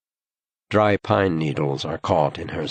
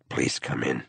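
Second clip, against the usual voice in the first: soft, lower coaxing; gentle; 5 semitones lower, 5 dB quieter than usual. A narrator's reading. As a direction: coax her in softly. Yona coaxes gently.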